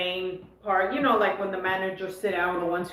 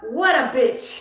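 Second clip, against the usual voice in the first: higher, louder mock Serbian accent; 5 semitones higher, 5 dB louder, slower than usual. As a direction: imitate her Serbian accent with punch. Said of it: "she even put that accent on".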